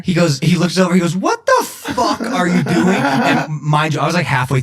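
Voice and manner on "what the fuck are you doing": drawn-out, higher imitation of baffled disbelief; hammed-up bewildered friend; mock incredulous question. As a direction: stretch it out in comic disbelief. Acts out baffled friend's reaction.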